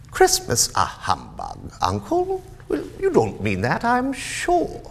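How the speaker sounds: nephew's voice lifting high; cheerful nephew. Acted. Nephew disputes his uncle's humbug, lightly protesting. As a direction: protest with a high lilt on the ends.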